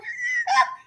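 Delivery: short high shriek of a laugh; high squeal of laughter. Real, not acted. Picturing inmates in the French Quarter, squealing laugh. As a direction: burst out laughing.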